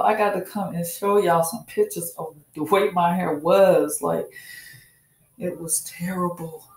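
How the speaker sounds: ordinary talk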